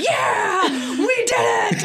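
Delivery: high, loud triumphant shout; celebrating shout for a friend's win; excited cheer. Retold or imitated. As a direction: yell it like you just won big. Conan cheering Colbert's win.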